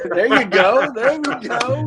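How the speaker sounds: high, loud laughing cheer; laughing approval. Real, not acted. Co-host applauding a friend's accent attempt, laughing. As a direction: cheer them on through real laughter.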